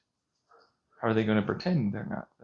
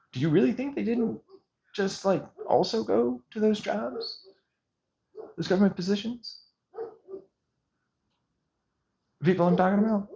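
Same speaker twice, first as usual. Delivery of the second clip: much higher incredulous voice; sarcastic disbelief; 9 semitones higher than usual. Real, not acted. Challenging viewers who trust those studies, higher and sarcastic. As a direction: ask it as if the idea is absurd.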